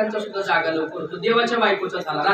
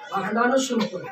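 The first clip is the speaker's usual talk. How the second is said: normal talking voice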